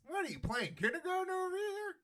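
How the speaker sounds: high-pitched mock incredulity; mocking scorn. Host mocking belief military protects interests, mocking scorn. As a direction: scoff way up high, as if at a child. Mocks naive view of military.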